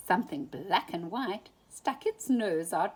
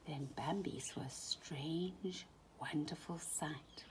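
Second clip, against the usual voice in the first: awed whisper; 2 semitones lower, 10 dB quieter than usual. A narrator's reading. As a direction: breathe the wonder of it. Awed narration.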